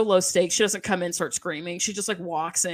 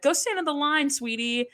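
mock sweet condescension; 6 semitones higher than usual. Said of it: "is like".

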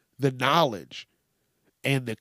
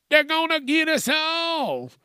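mock paranoid squeal; 13 semitones higher than usual. Paranoid mock alarm about chips.

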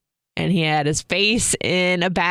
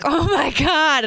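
stunned amazement; 10 semitones higher, slower than usual. Retold or imitated. Husband reacting to pregnancy news.

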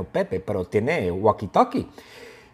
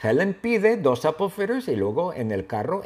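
usual speaking voice

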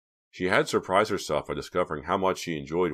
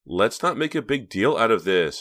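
higher, louder eye-rolling dismissal; exasperated; 5 semitones higher, 6 dB louder than usual. A narrator's reading. Reva waves away Hank's fuss, exasperated. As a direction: brush it off with impatient emphasis. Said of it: "rolling her eyes".

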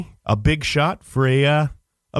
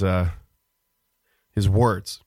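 ordinary delivery

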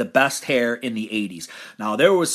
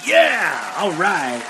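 excited cheer; much slower than usual. Real, not acted. Cheering the winner.

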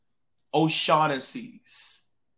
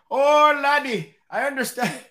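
hammed-up Irish voice, pitched high; Irish accent; 7 semitones higher, 5 dB louder than usual. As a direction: put on a big Irish barman's accent. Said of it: "Don't y'all laugh at my Irish accent".

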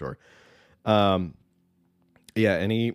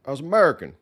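mock politician speech; 12 semitones higher than usual. Host mocking Democrats' shared speaking style, high mock orator voice. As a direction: imitate a politician rallying the crowd. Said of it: "they're always like".

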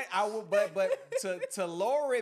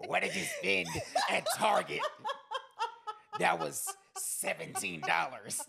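mock wife interrogation; 2 semitones higher, slower than usual. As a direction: grill him about the receipt, amused. Husband voices wife's phone interrogation.

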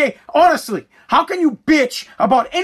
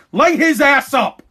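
ordinary delivery

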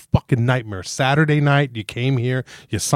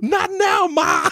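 high, yelled cry of "Ma!"; De Niro yelling Ma; much slower than usual. Retold or imitated. Grown man in basement yelling up to his mom, high cry. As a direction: bellow up the stairs like a grown son in the basement. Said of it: "De Niro in The King of Comedy".

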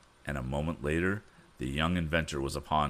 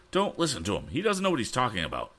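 exclaimed; 7 semitones higher, faster than usual. A narrator's reading. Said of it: "exclaimed the former submarine boat agent".

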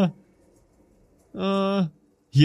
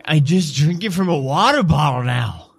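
higher, put-on vampire voice; decrepit old vampire; 6 semitones higher than usual. Old vampire admits his feeding habits, high put-on voice. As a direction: answer as an aged vampire, pitched up. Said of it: "decrepit looking vampire with a walking stick".

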